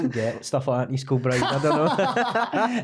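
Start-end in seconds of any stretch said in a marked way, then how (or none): 1.6-2.8 laughing. Real, not acted. Teasing about East Kilbride bread.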